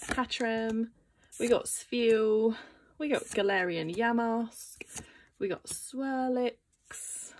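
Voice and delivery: ordinary talk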